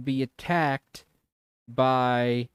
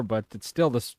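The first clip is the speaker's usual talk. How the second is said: ordinary talk, nothing marked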